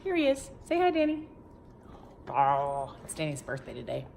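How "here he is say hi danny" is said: high coaxing voice to a little one; high sing-song prompting. Real, not acted. Coaxing child to greet viewers.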